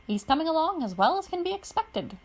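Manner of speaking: put-on a jolly old gent reassuring the girl; cheerful Coran. Coran reassuring Pidge about her father, chipper.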